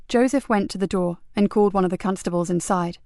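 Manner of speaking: plain reading voice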